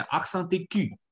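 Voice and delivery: "accent aigu" run together as one French phrase; teacher's joined-up demo of "accent aigu"; linked French pronunciation. Shown as a demonstration. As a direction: link "accent" smoothly into "aigu". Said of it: "我们连着念".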